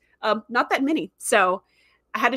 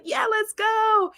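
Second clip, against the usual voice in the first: high, slow, drawn-out cheer; 12 semitones higher, much slower than usual. Retold or imitated. Fan cheering on the heroes, high slow cheer. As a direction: cheer it like you just jumped up from the couch. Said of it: "Like, I started cheering".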